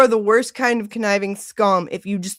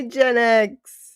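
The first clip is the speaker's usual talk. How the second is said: usual speaking voice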